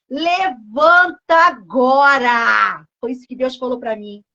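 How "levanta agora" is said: very slow, stretched-out order; lower, drawn-out preacher's imperative; commanding. Acted. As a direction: draw out each word as a divine command. Acts God's command to get up.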